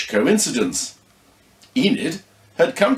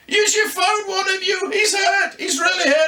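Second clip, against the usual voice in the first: much higher shouting, urgent alarm; panicked scream. Acted. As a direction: yell for help, terrified. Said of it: "Then she screamed".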